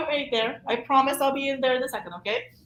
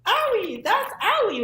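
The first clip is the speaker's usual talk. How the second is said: doll voice, higher and playful; 9 semitones higher, slower than usual. Shown as a demonstration. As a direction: squeak it like a hurt toy doll. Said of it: "having another doll saying".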